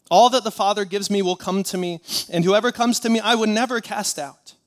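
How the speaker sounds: loud, ringing pulpit proclamation; full-force scripture proclamation. Real, not acted. Preacher giving the church the words of Jesus, proclaimed. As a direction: proclaim it loudly to the whole church.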